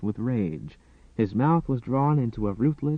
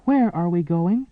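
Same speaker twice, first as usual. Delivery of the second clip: pleading; 7 semitones higher, 5 dB louder than usual. A narrator's reading. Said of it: "she almost begged".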